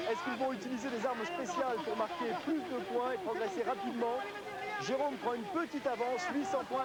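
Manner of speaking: high, excited game commentary; live play-by-play. Acted. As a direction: call the race as it happens, climbing.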